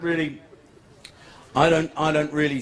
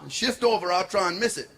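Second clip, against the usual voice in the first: put-on raised Jamaican-accented reply; reply matter-of-fact in a Jamaican accent; 3 semitones higher than usual. [name] answers her warning, Jamaican accent. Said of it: "This is my Jamaican accent now".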